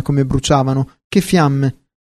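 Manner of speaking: ordinary delivery, nothing marked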